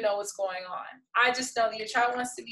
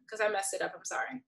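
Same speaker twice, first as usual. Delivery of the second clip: normal talking voice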